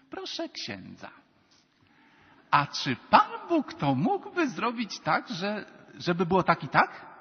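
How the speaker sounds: high, loud, drawn-out mock questioner; slow, exaggerated querying of a priest; mock questioning of priest. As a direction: imitate a pestering parishioner, pitch swooping up. Acts out people challenging a priest.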